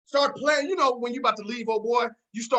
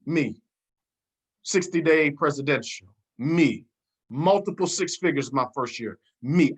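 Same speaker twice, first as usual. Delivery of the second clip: ordinary talk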